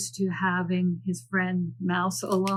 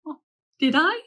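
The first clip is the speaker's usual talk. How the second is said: coy innocent; 7 semitones higher, 3 dB louder than usual. Acted. Mouse plays innocent.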